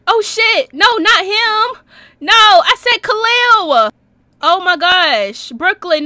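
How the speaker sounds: high, loud, drawn-out protest; loud dismay. Real, not acted. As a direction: cry out as it all goes wrong. Wrong character goes in the game.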